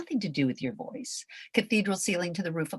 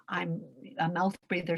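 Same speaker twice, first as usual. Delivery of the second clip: ordinary talk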